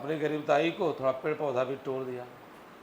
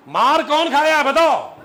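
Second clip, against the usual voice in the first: much higher, loud demanding shout; mock outraged shout; 10 semitones higher, 13 dB louder than usual. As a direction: demand loudly like an outraged mother. Mother demands who was beaten.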